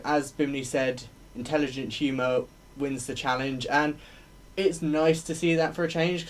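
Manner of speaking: normal talking voice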